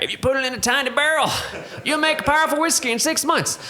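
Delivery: very high-pitched, hammed-up caricature; mock hype voice. Whiskey maker parodies the tiny-barrel Texas boast, high silly voice. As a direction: squeal it like a silly sales boast.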